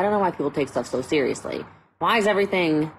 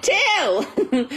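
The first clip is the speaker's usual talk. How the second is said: proud answer; 14 semitones higher than usual. Retold or imitated. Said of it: "I said".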